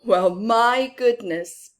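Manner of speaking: ordinary delivery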